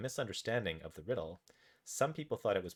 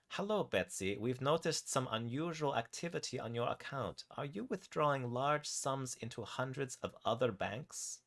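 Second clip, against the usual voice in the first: put-on higher-pitched German-accented caller; 5 semitones higher than usual. German banker querying Betsy's unusual account activity, German accent. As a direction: speak as a German bank woman, pitched up. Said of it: "A woman with a German accent".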